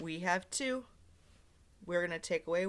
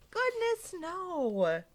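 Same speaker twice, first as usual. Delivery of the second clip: much higher, bright sing-song exclamation; high cheerful refrain; 9 semitones higher, 4 dB louder than usual. Acted. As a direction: toss it off lightly, nothing to worry about.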